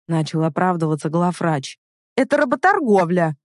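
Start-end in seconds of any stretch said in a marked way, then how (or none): 2.2-3.4 high-pitched indignant bark; outraged, raised-pitch protest; barked outburst. A narrator's reading. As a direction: bark it, losing your temper. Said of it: "рявкнула я, окончательно выйдя из себя".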